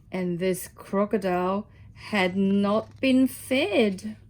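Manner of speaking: slow, menacing emphasis; slow it down, ominously. Acted. Ominous slowed crocodile line.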